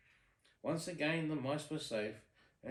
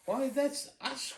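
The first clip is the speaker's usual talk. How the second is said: high, loud, slow squeal of delight; excited cry; 12 semitones higher, 8 dB louder, slower than usual. Acted. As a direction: shriek with glee at the news. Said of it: "cried Anastasia and Drizella".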